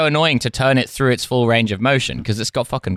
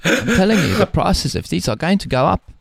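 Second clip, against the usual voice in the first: high-pitched South African salesman; hard sell in a put-on South African accent; Elon Musk; 7 semitones higher than usual. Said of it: "cold calling salesman for Tesla".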